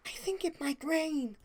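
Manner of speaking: soft, high and timid; quiet Fluttershy. A narrator's reading. Fluttershy softly fretting to friends about rain. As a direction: murmur it shyly, a worried filly. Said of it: "Fluttershy said quietly".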